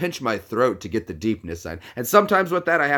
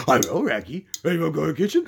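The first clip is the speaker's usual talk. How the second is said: Scooby-Doo impression; 5 semitones higher, much faster than usual. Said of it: "I can do Scooby-Doo".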